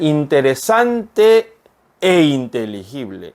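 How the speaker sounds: "interesante" then a clear break before "e inteligible"; separated e. Shown as a demonstration. Teacher demonstrating the separated "e" to students. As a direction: pause and give "e" its own beat. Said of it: "이렇게 불러주는 게 맞습니다".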